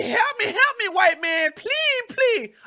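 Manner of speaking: high, loud mocking plea for help; mock begging. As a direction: ham up a pleading beggar to mock it. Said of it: "I didn't say".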